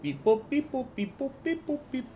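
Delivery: rising beep boop robot noises. Pretend. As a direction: chirp it like a little computer. GM makes computer noises.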